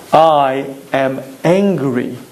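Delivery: low and very slow, each word weighed; slow self-admission. Shown as a demonstration. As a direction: admit it slowly, deliberately, to yourself. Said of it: "knowing the following yesod".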